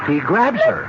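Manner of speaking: high panicked cries; frightened. Acted. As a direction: cry out in fright. Woman grabbed by stranger.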